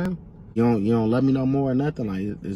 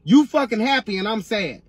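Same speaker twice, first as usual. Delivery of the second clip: heated hurt; 6 semitones higher, 4 dB louder than usual. Acted. Heated breakup argument.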